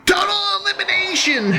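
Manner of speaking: high, full-throated event-title shout; Joey Styles. Acted. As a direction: bellow it like an over-excited wrestling announcer. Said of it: "that was my Joey Styles impression".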